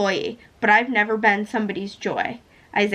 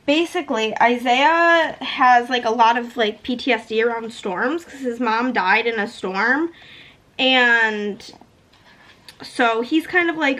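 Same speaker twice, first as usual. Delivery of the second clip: usual speaking voice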